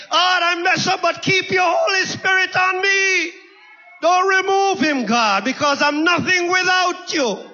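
high, shouted prayer from the pulpit; crying out to God. Acted. Preacher praying as David to keep the Holy Spirit, high shouted plea. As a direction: cry out the plea at full preaching pitch.

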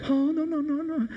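high, frantic pleading of a nervous Italian driver; upset Italian chauffeur. Acted. Chauffeur begs the Pope to keep the windows up, high and frantic. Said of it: "the Italian chauffeur gets very upset".

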